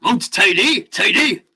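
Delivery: put-on Welsh lilt, rising on "tidy"; Welsh accent. Streamer joking that Mount Teede is pronounced tidy, mock Welsh. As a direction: play it very Welsh with a growl. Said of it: "when you do the Welsh".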